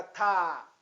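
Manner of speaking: "tá" held long and falling; lengthened tá. Shown as a demonstration. Teacher models reading the letter, lengthened "tá". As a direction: draw out the vowel of "tá". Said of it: "Alonga um pouco".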